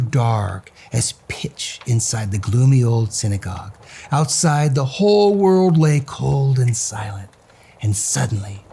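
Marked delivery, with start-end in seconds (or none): none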